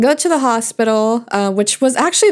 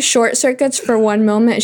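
ordinary talk, nothing marked

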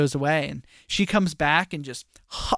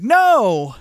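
higher and louder hammed-up protest; mock anguished no; 6 semitones higher, 8 dB louder than usual. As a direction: throw out a quick mock cry of denial. Simon protests in retold scene.

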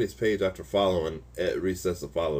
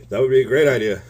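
louder excited retelling; excited exclaim; 5 semitones higher, 9 dB louder, faster than usual. Retold or imitated. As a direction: burst out with enthusiasm.

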